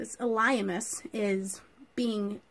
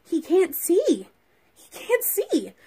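a touch of panic; 5 semitones higher, 5 dB louder, slower than usual. Acted. Teller echoing the blinding.

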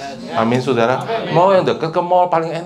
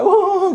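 high-pitched fake bawl; comic wail, hammed up; exaggerated sobbing cry; mock crying wail; 4 dB louder than usual. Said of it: "Saya datang, saya nangis".

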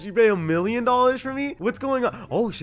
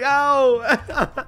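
mock ow; 5 semitones higher, 3 dB louder than usual. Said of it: "I'm gonna be like".

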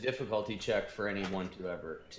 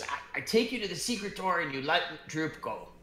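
high goblin voice, a little eager; excited goblin; 7 semitones higher than usual. Acted. Goblin bargaining with the party for freedom, a little eager. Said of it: "Droop looks a little more excited".

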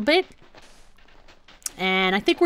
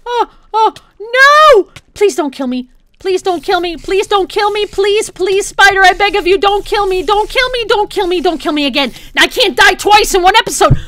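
high, loud frantic begging; panicked pleading; 8 semitones higher, 6 dB louder than usual. Real, not acted. Gamer begging a spider not to kill him, panicked. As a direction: plead desperately for your life.

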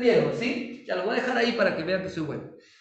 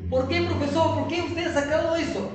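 a hint of a puzzled pupil, pitched higher; lightly voiced student asking the teacher why; 7 semitones higher than usual. Teacher playing a student questioning him, lightly higher voice.

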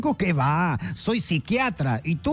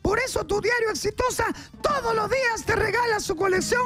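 much higher, hammy announcer sell of the daily paper; hyped radio ad read. Acted. Host plugging the newspaper to listeners, in a booming hyped ad voice. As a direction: pitch the paper like a carnival barker.

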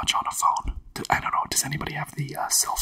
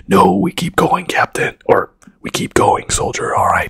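louder stern captain order; commanding captain; 6 semitones higher, 11 dB louder than usual. Pretend. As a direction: bark it like a captain. Ship roleplay, captain pushing on.

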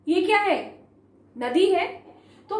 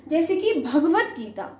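ordinary talk

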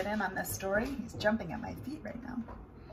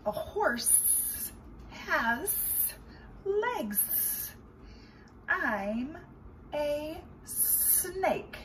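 slow drawn-out snake voice; 5 semitones higher, much slower than usual. Acted. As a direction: stretch every word like a snake. Snake character speaks slowly.

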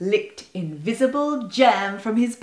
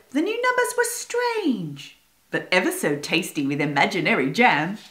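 plain reading voice

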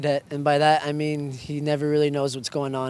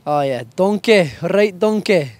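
put-on Shrek Scottish accent; 3 semitones higher, 7 dB louder, slower than usual. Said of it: "I could do the Scottish accent".

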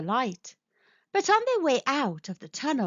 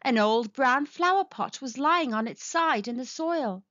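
ordinary delivery, nothing marked

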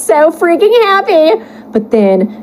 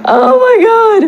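shocked; 11 semitones higher than usual. Real, not acted. Cringing reaction.

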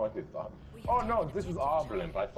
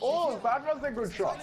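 impressed; 11 semitones higher than usual. Real, not acted. Praising a teammate's shot, sounding excited. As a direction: react with delight at the shot. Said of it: "I'll be honest".

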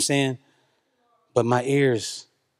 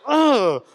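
embarrassed groan; 10 semitones higher, 4 dB louder than usual. Retold or imitated. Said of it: "I was like".

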